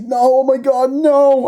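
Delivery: hammed-up, high, loud wail; mock terror. Imitating the guy getting eaten on the boat, hammed-up terror. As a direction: cry out like a doomed movie victim.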